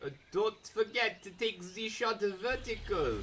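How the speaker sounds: slow, hammed-up French accent; unhurried amateur French accent. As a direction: put on a mock French accent and draw it out. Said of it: "That's my French accent".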